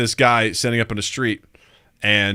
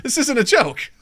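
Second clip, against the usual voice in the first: much higher, laughing disbelief; stunned delight; 11 semitones higher than usual. Retold or imitated. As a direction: squeal like a starstruck fan. Audience seeing [name].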